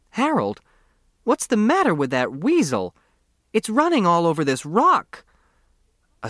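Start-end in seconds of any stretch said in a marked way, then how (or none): 0.1-5.2 high, rising alarm, a woman startled by a weasel. A narrator's reading. Grace calls to Harold in alarm about the weasel, high and anxious. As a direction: call out to Harold, suddenly nervous and much higher.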